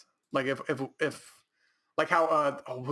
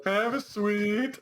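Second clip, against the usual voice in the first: higher, drawn-out mock kid question; child asking; 5 semitones higher than usual. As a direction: play a child begging slowly for candy. Replays child asking for sweets.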